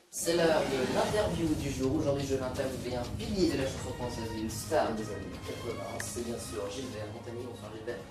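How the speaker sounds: TV host announcing the interview; bright talk-show presenter read; news anchor parody. Acted.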